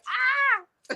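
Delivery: excited squeal. Retold or imitated. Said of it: "I'm like".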